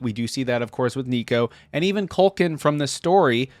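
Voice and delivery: usual speaking voice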